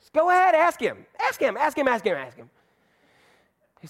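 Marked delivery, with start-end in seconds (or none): 0.1-2.5 put-on pushy little girl. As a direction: badger him like an eager kid. Girl urging in retold story.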